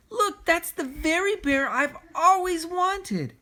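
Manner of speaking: put-on high, slow little girl's plea. A narrator's reading. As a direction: voice the excited little girl asking her mommy. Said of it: "she said".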